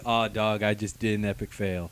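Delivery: low, soft dumb hero voice; mock dopey hero. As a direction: mumble it like a clueless dude. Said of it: "his response to it".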